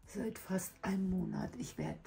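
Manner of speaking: sick voice giving out mid-sentence. Real, not acted. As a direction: voice failing, dropping to a whisper. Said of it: "meine Stimme versagt".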